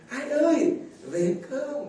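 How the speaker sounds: a hint of the mother calling shyly; lightly voiced shy mother. A mother calling her husband home, lightly voiced. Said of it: "retelling mother's call".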